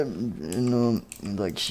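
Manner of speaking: ordinary delivery, nothing marked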